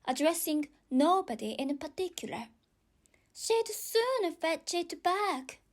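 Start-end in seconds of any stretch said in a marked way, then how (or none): 3.3-5.6 put-on girl voice. Alice about Dinah.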